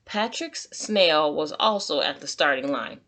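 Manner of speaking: plain reading voice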